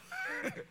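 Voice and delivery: short squeaky laugh; a quick high giggle; high-pitched giggle. Real, not acted.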